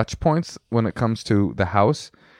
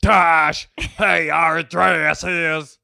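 lightly voiced, a bit higher mock of his wife; slow, raised mock of her catchphrase; a hint of the wife's mock voice; impression of wife; 5 semitones higher, much slower than usual. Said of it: "pretty good impression of me".

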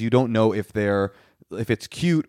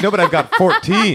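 mock menu thief; 9 semitones higher, 6 dB louder than usual. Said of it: "he's like".

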